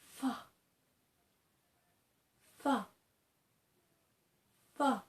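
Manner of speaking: soft, breathy fa with teeth on lip; gentle fa. Shown as a demonstration. Teacher showing a gentle, soft fa. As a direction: barely voice the fa, gently. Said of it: "when you're doing it gently".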